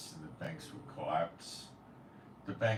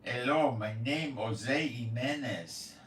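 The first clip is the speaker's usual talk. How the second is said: a hammed-up Spanish-accented English, slow; imitating a foreigner's broken English in a Spanish accent; a mock Jose Jimenez Spanish accent, said slowly; 5 semitones higher, 4 dB louder, slower than usual. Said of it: "It sounds more like".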